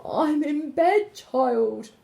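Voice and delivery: a wolf faking a frail grandma; wolf's old lady voice. A narrator's reading. Wolf in bed calls to the girl, faking grandma's voice. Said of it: "called the wolf in his best old lady voice".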